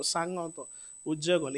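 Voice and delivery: normal talking voice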